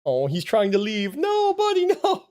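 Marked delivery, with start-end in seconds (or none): 1.2-2.3 much higher, louder pleading no buddy; high squeal stopping the cat. Real, not acted. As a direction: yelp after the escaping cat. Cat trying to leave.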